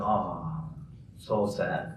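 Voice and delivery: soft, feigned sorrow; quiet sarcastic letdown; mock disappointment. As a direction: sigh it out in pretend dejection. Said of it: "kind of using sarcasm".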